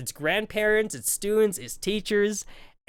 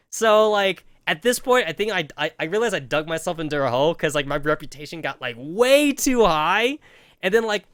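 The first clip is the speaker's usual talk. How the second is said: ordinary talk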